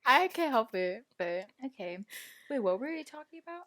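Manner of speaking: ordinary talk, nothing marked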